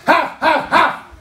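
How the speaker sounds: very high, loud barks; mighty guard dog bark. Acted. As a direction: bark like a huge watchdog. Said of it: "jsem mohutnej, obrovskej". (mohutný psí štěkot)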